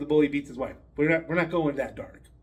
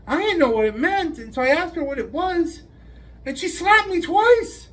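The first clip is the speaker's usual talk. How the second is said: put-on much higher child's voice, earnest; little boy explaining; 9 semitones higher than usual. Jimmy telling the principal what happened, high child voice. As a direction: tell it like a hurt kindergartner.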